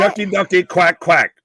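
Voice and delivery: high, loud Booker T catchphrase; Booker T impression. Podcast host shouting Booker T's catchphrase, loud and high. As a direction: go fully committed to the Booker T voice. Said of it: "you gotta say it in the Booker T accent".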